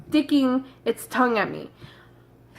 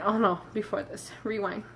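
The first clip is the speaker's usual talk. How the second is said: ordinary delivery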